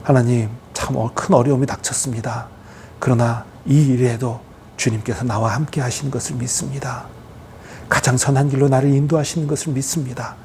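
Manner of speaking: calm, low, soft prayer; mature believer praying. Acted. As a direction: pray quietly with steady trust. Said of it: "이게 성숙한 신앙인의 태도인".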